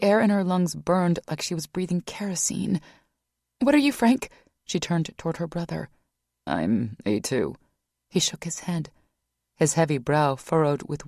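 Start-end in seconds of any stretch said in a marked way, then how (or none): 3.6-4.3 high loud urgent; panicked question. A narrator's reading. Panicked ask. 6.5-7.6 much lower, slow male voice; brother's reply. A narrator's reading.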